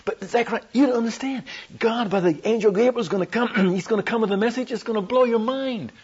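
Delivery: high, insistent plea, acted out; excited urging. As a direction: plead eagerly with a doubter, pitched high. Urging a doubtful Zacharias to believe.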